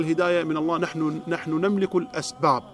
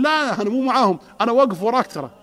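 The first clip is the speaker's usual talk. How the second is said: raised, louder insistence; insistent retelling; 5 semitones higher, 5 dB louder than usual. Retold or imitated. The convert insisting to the preacher, loud and eager. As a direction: press the point eagerly.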